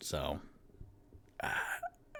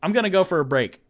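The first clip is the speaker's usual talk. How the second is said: flustered outburst; 7 semitones higher, 8 dB louder than usual. Real, not acted. Panicked admission of no plan.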